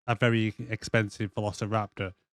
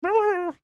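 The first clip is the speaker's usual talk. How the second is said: high raptor squeak; 3 dB louder than usual. Retold or imitated. Raptor noise.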